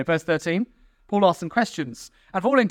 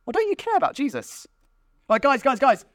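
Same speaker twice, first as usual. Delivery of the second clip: high-pitched earnest protest; mock-dismayed do-gooder; hammed-up pleading; 7 semitones higher than usual. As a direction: break up the squabble in a pleading high voice. Acted plea among squabbling factions.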